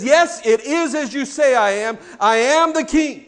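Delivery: rising to a loud, high proclamation; Jesus answering Pilate with full certainty; bold declaration. Acted. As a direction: proclaim it like a king.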